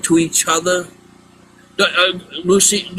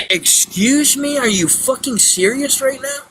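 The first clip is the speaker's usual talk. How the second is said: outraged disbelief; 10 semitones higher than usual. Retold or imitated. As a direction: act shocked and appalled. Retelling outrage at seller's reply.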